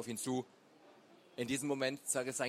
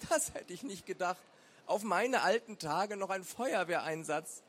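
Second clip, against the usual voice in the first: put-on higher, hoarse old woman's voice; a touch of hoarseness, pitched up; 6 semitones higher than usual. A narrator's reading. Elderly neighbour after putting out the fire, lightly hoarse. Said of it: "Frau Kuhns Stimme klang heiser".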